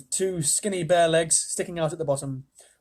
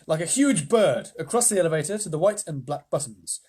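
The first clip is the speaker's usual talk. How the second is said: ordinary delivery, nothing marked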